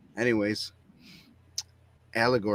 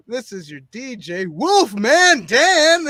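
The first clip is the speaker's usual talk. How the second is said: DJ intro rising to a howled name; howling radio jock sign-on; Wolfman-style DJ; 10 semitones higher, 8 dB louder, slower than usual. Pretend. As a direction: build to a high, loud, stretched yell. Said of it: "can you pull the Wolfman Jack voice".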